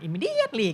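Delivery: "immediately" with the stress shifted off ME, stretched; mock misreading of "immediately", drawn out; others' mispronunciation. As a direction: imitate a wrongly stressed "immediately". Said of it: "คนอื่นเขาอ่าน immediately กันไปแล้ว".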